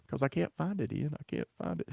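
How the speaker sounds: host whispers while searching for the comment; whispered frustration. Real, not acted. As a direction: whisper it in defeat.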